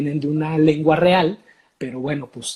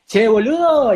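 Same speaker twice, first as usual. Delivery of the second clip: higher, louder mock Argentine; fake Argentine accent; 7 semitones higher, 7 dB louder than usual. Foreigners' cliché of Argentine speech, mock Argentine accent. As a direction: toss it off like a caricature porteño. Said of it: "que solo por decir".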